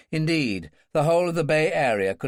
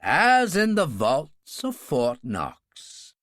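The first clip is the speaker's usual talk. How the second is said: slow, composed and smiling; smiling composure; 3 dB louder, much slower than usual. Acted. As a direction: reassure with a smile, slowly. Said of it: "regained his smiling composure".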